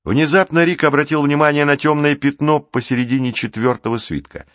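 ordinary delivery

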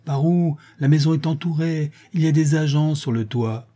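high, insistent protest; exasperated insistence. A narrator's reading. Cornered inspector insists to his chief.

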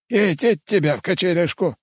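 high, put-on old man swearing; cursing old cook. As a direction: curse like a grumpy old cook. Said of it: "выругался повар".

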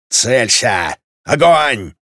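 drawn battle commands; gunnery officer's command shout; yelling. A narrator's reading. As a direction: call the firing orders to gunners. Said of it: "орёт в уши растерянным и оглохшим канонирам".

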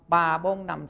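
plain reading voice

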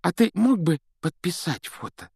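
put-on high, excited little boy voice; eager schoolboy fan. A narrator's reading. Boy asks his idol for a signed photo, eager. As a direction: plead for a photo in a high, boyish voice.

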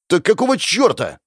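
high, indignant outburst; explosive anger. A narrator's reading. Hero demands where the money went, exploding. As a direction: blow up at him. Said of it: "взорвался я".

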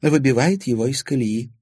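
put-on old lady describing a controlling man; knowing old lady. A narrator's reading. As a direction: explain his ways knowingly.

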